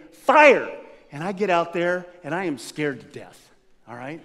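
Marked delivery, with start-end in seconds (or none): 0.1-0.7 loud, higher "Fire!"; shouted command. Retold or imitated. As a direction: bark the order to shoot. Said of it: "and then he'll say".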